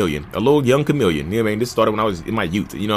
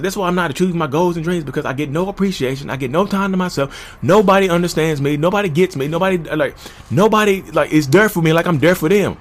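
imitating his own whiny, self-pitying complaint, pitched higher; retold high-pitched rant; 8 semitones higher than usual. A husband replaying the complaints he yelled at his wife, as a whiny high-pitched rant. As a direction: replay the tantrum with mock indignation, pitched up.

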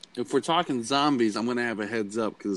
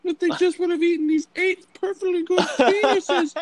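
much higher, fussy rescuer voice; mock rescuer. Retelling a comedy skit, rescuer in a high mock voice. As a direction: squeal it like a shocked rescuer. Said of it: "they're like".

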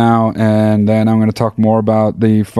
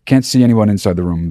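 normal talking voice

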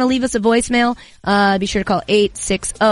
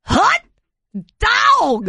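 higher Harry Carey; 12 semitones higher than usual. Acted. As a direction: push the Hot dog way up into a squeal. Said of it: "like Harry Carey".

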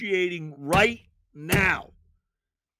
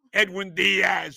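ordinary talk

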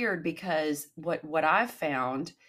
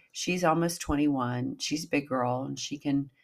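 ordinary delivery

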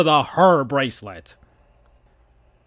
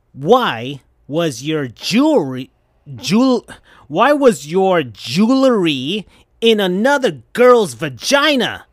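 louder, higher accusing scream; Sonic's hurt, furious outburst; 5 semitones higher, 4 dB louder than usual. Acted. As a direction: yell the question at her. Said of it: "screamed Sonic".

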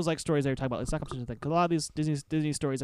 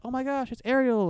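put-on high starstruck little-kid voice; excited kid; 8 semitones higher, 4 dB louder than usual. Kids meeting Ariel after the show, high gushing. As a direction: gush like a child meeting a princess. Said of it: "some kids who were like".

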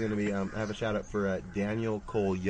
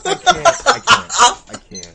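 laughing helplessly; 12 semitones higher, 13 dB louder than usual. Real, not acted. Overcome with laughter.

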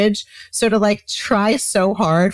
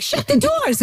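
yelling. Retold or imitated. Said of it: "I'm, like, kind of yelling at her".

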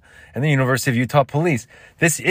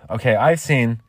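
ordinary talk, nothing marked

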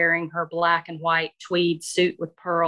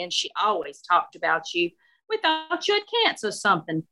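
confiding Southern matron with lifts in pitch; gossipy older Southern lady. Acted. Shirley tells Elizabeth what the friends heard, gossipy Southern lady.

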